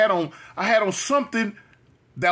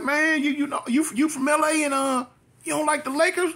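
much higher-pitched mock questioning; imitated disbelief; 10 semitones higher than usual. People questioning an L A native about the Lakers, imitated disbelief. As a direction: mimic a puzzled acquaintance, voice pitched way up.

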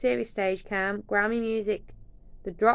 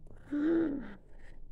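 gasp; 7 semitones higher than usual. Real, not acted. Gasp at merch.

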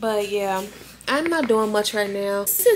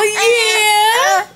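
put-on squeaky high, louder baby-talk yeah; high cooing yeah; 11 semitones higher, 5 dB louder than usual.

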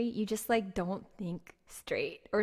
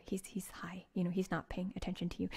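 whispered warning to a friend; 3 semitones lower, 8 dB quieter than usual. Retold or imitated. Friends whispering to Laura on the bus, urgent whisper. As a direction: hush her urgently under your breath. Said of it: "my friends were like".

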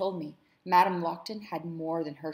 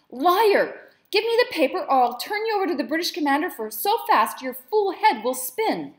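much higher, screaming furious threat; shaking rage; 10 semitones higher, 12 dB louder than usual. Acted. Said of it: "her voice shook with rage".

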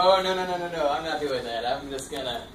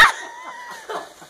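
shrieking laugh from the cold; scream-laugh as ice water hits; high laughing scream; 5 dB quieter than usual. Real, not acted. Ice water just hit him.

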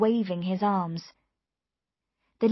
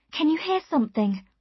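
high, keen question; urgent alert; 8 semitones higher, 4 dB louder than usual. A narrator's reading. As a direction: ask urgently, pitched well up. Urgent question about approaching sound.

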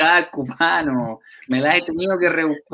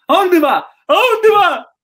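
put-on very high, loud drunken shouting; drunk annoying yell; 8 dB louder, slower than usual. Tipsy passenger yelling at the driver, very high shout. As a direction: keep hollering the question, tipsy and obnoxious. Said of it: "entre curado y pesado".

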